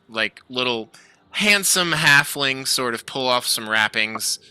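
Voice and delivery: ordinary talk, nothing marked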